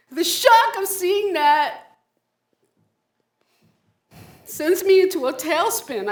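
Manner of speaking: sudden high loud jolt of shock; shocked outburst. Acted. Recalling the shock, voice jumps.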